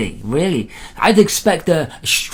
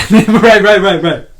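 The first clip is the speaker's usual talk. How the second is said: laughing loud and high through the words; 4 semitones higher, 10 dB louder, slower than usual. Real, not acted. Host laughing at the guest's joke, laughing agreement. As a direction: chuckle out each right in agreement.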